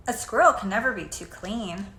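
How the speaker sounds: ordinary delivery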